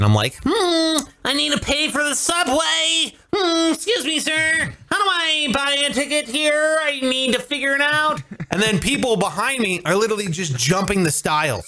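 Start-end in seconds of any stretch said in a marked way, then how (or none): 0.4-8.2 high, fussy voice of a rule-following tourist; mock uptight American asking a subway worker how to buy a ticket; prissy law-abiding American. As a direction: play the timid, overly polite American asking for help. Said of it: "I'm such a law-abiding American".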